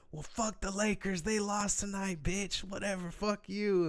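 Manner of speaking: high, put-on voice of a drunk taunting; mock taunting yell. Little drunk guy taunting the other man about the Lakers, high mocking yell. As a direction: throw the insult over your shoulder as you run. Singer yells taunt running out.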